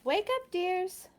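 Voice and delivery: high, slow calling-out mother. A narrator's reading. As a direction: sing it out like a mother waking children. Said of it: "Mother's voice called".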